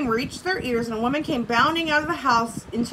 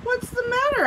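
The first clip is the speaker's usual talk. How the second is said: much higher, gentle storybook question; concerned asking; 12 semitones higher than usual. A narrator's reading. Tiger crouching before the crying baby, concerned. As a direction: ask it high, as to a small child.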